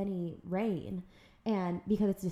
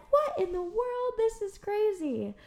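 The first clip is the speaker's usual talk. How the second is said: much higher, stunned disbelief; higher pitched amazement at the dolphins; amazed surprise; 11 semitones higher than usual. Retold or imitated. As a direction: gasp it out in wonder. Seeing dolphins at the wedding.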